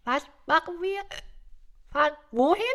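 high, loud, befuddled character voice; Rudolf the reindeer. Acted. Reindeer summoned by Santa, asking in high confusion. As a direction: answer bewildered, in a high voice.

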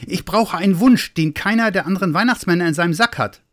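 high, excited little Santa; agitated high voice, speeding up; too excited. A narrator's reading. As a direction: rush it out, pitched well up. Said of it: "war viel zu aufgeregt".

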